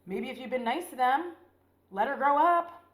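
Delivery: yelling at the soldiers in the movie; indignant outburst. Real, not acted. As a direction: tell them off loudly.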